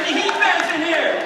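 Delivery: loud, high shout to the audience; rally the crowd with it. Real, not acted. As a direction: whip up the fans in the hall. Host polling the crowd.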